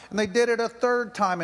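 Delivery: ordinary delivery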